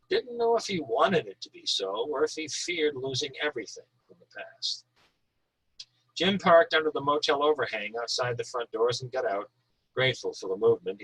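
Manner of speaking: calm, measured reading of a novel excerpt; low, even storytelling voice; story reading. A narrator's reading. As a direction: read the chapter like an audiobook narrator. Author reads chapter aloud.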